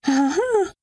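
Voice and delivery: high-pitched staged laughter; high "ha ha ha" burst; involuntary laugh, acted. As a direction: laugh high against your own will. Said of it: "Zu dumm, dass ich lache".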